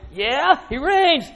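high, drawn-out "he reigns"; excited proclamation. Real, not acted. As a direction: lift it up with excitement. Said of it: "Get excited?".

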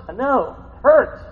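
usual speaking voice

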